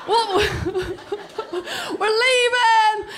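high sing-song call, stretched out with laughter. Acted. Teenager sneaking out past deaf parents, calling out. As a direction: announce it high and drawn out.

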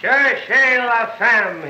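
slow, drawn-out declamation; ringing orator's cry. Acted. Reporter quoting the DA's speech for his story, ringing and grand. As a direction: proclaim it like a courtroom orator. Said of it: "voice rang out like a pistol shot".

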